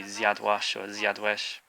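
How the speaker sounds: "zjadłaś or zjadłeś" said slowly in Polish; Polish pronunciation. Shown as a demonstration. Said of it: "you ate would be".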